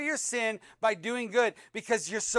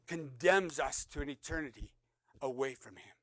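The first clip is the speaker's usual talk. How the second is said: usual speaking voice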